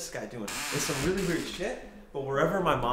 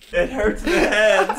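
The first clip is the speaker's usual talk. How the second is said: laughing; 6 semitones higher, 13 dB louder, slower than usual. Real, not acted. Laughing fit after fall.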